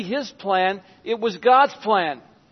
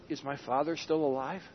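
soft hushed question; 5 semitones lower, 11 dB quieter than usual. Acted. Joseph asks about his father.

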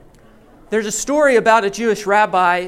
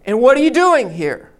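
loud, higher repeated challenge; shouting again; 4 semitones higher, 5 dB louder than usual. Retold or imitated. Guard shouts the questions at the rabbi again, loud shout. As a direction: call it out again like a sentry. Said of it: "The voice shouts again".

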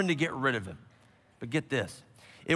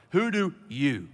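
"you" set apart and held; deliberate question weighted on "you"; stress on YOU; 3 semitones lower, slower than usual. Shown as a demonstration. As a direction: lean on "you" at the end. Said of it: "notice this emphasis on the word you".

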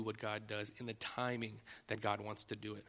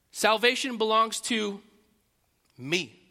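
mock boast; 7 semitones higher, 10 dB louder, slower than usual. Mocking the false claim.

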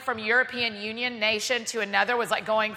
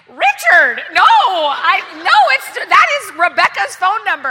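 frantic insisting; 8 semitones higher, 11 dB louder, slower than usual. Retold or imitated.

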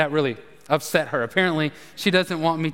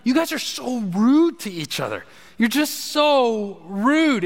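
scolding sons; 5 semitones higher, slower than usual. Retold or imitated. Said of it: "I'm constantly telling them".

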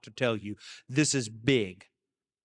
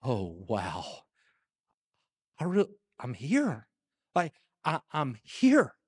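quiet amazement; slower than usual. Retold or imitated. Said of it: "shockingly surprised".

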